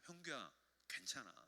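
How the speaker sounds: very soft, tender comfort; hushed, fatherly reassurance, acted; God reassuring. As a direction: speak gently as God consoling a child. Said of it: "하나님이 그때 뭐라고 하신다고요?".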